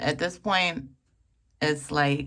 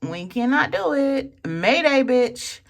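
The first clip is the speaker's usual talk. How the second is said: body pleading; 6 semitones higher, 4 dB louder than usual. Pretend. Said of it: "My body was like".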